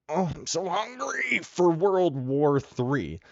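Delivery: high, drawn-out hammed-up pleading; mock begging. Mocking a war-hungry government, slow high-pitched begging. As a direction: beg slowly in a high put-on voice. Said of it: "begging, just".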